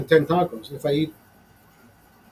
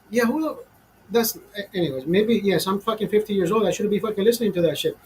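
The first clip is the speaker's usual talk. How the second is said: normal talking voice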